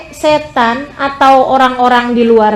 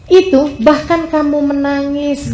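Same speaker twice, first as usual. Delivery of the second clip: ordinary talk, nothing marked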